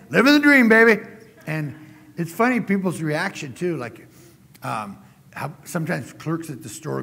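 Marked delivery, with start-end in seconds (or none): 0.1-1.0 put-on loud, high, cheerful "Living the dream, baby"; answering a neighbor's greeting, loud and beaming; cheerful catchphrase. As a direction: shout it back to the neighbor with gusto. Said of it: "like my neighbor the other day".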